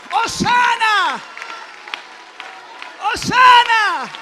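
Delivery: high, very loud, drawn-out cry; fervent shout. Shown as a demonstration. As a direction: lead the congregation in shouted praise. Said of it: "grítelo como este niño".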